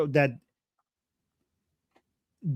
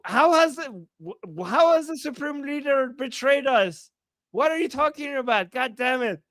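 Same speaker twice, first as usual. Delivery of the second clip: indignant anger; 6 semitones higher than usual. Retold or imitated. Translating indignant comment.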